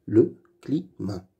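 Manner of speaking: Le climat split into le, cli, mat; syllable by syllable. Shown as a demonstration. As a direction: read "Le climat" in separate syllables. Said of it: "Lis les mots".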